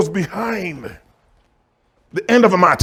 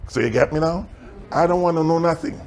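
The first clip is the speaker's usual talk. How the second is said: ordinary talk, nothing marked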